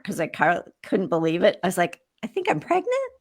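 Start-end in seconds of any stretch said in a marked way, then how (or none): 2.2-3.1 pregnant rising high, said as an unsure question. Retold or imitated. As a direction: end "pregnant" on a big upward question lilt. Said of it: "I said this, like, a question mark".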